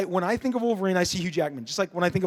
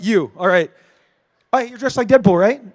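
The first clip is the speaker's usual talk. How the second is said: ordinary talk, nothing marked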